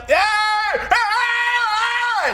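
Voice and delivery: high, drawn-out mock yell; hammed-up long yell; imitated yelling. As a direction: holler like someone yelling at the TV. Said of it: "He, he, he yelled".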